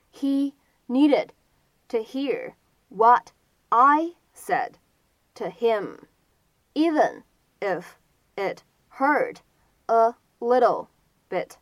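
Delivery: very slow, a clear pause between chunks; slow word-by-word demo. Shown as a demonstration. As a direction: model each word separately for learners. Teacher repeats sentence slowly, word by word.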